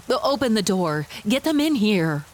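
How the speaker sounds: firm, urgent command; concerned calling down. A narrator's reading. Guard ordering the door opened for the injured, concerned call. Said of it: "called down with naked concern".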